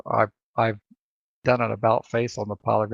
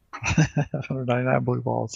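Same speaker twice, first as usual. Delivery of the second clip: laughing; slower than usual. Real, not acted. Laughing at the blue balls joke.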